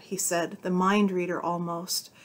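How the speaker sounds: ordinary delivery, nothing marked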